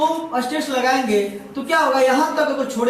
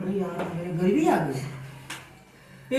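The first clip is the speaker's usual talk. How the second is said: mock lament; 5 semitones lower, 7 dB quieter, much slower than usual. Material laments its plight.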